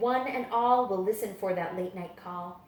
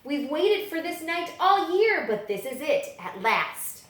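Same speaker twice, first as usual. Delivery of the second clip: ordinary delivery, nothing marked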